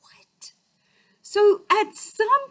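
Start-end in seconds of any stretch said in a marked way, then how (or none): 0.0-0.5 whispered what; stunned whisper. Retold or imitated. Hearing her credit is good, stunned whisper. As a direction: breathe the word in shock. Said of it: "I'm like".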